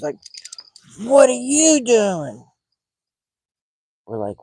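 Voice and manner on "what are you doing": high, loud, drawn-out mock shriek; screaming lady impression. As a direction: yell it like an outraged neighbor, stretched out. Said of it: "And she's screaming".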